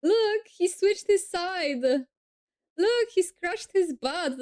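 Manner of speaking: high-pitched, tickled commentary with a laugh; amused delight. Real, not acted. Streamer watching a sleeper switch sides, amused and delighted.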